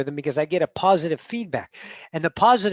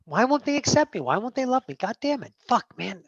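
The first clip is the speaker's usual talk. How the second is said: higher-pitched frustrated plea; exasperated pleading; 5 semitones higher than usual. Acted. Upset self begging for acceptance, exasperated pleading. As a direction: plead in frustration at being rejected.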